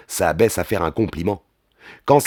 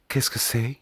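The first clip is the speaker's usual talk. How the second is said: soft, lightly raised woman's voice; Molly's voice; 3 semitones higher, 8 dB quieter than usual. A narrator's reading. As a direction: ask it softly, as the female partner. Narrator voices Molly asking. (voix féminine douce)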